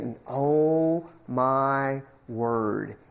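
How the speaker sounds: drawn-out, hushed oh my word; slow awed sigh. Retold or imitated. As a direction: let the awe stretch each word. Awed by the owner's playing.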